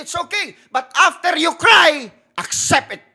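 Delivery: high, loud fervent preaching; full-force preaching. Acted. Pastor urging the congregation to cry out, loud and high. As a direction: preach it hard to the crowd.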